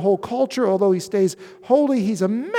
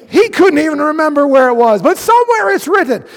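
playful emphasis; 5 semitones higher, 7 dB louder than usual. Acted. Playful jab at Hebrews writer.

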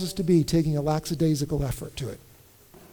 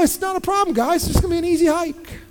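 breezy dad; 9 semitones higher than usual. Retold or imitated.

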